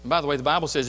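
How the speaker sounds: ordinary talk, nothing marked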